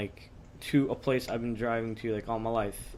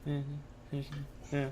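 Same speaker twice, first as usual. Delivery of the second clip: quiet Carter imitation; 3 semitones higher, 11 dB quieter than usual. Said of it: "Carter's like".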